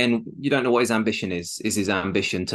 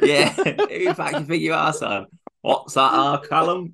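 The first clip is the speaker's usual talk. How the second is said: higher, cocky mock Essex taunt; Essex accent; 8 semitones higher, 4 dB louder than usual. Podcaster imitating a teammate mocking Callum, in a cocky Essex accent. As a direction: taunt him like a brash Essex lad. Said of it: "Callum Watson comes in all Essex".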